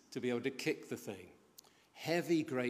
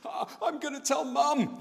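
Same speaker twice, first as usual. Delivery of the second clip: high, strained, gasping for breath; winded voice; 13 semitones higher, 3 dB louder than usual. Retold or imitated. Winded boy threatening to tell on his uncle, high winded voice. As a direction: squeeze the words out as if winded. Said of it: "that voice that you can only bring out when you, when you're really winded".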